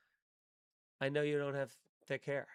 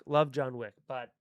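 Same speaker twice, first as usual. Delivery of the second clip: ordinary talk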